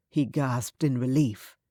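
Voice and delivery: ordinary delivery, nothing marked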